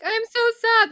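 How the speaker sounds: high, hammed-up whiny "so sad"; mock sad. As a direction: parody a fake-sad pout. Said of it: "but, like, sad".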